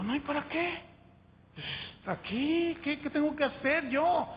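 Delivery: high, pleading questions; eager young convert. Retold or imitated. New convert pressing his sister, high and eager. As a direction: push to know what to do now. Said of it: "Le digo".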